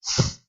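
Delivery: soft sustained "s" hiss; unvoiced "s" held alone; demonstrated hissing "s"; isolated s sound. Shown as a demonstration. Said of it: "Então, ó".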